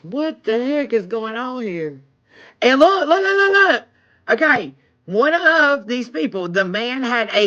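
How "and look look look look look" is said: high, rapid-fire repeated looks; real burst of excitement; excited urging. Real, not acted. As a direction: urge them to see it, pitched right up. Urging viewers to see the find.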